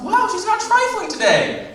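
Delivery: very high, slow amazed squeak; squeaky amazement that the clerk complied. Acted. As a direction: marvel at it in disbelief. Surprised aside.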